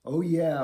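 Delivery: relaxed yawn sigh. Shown as a demonstration.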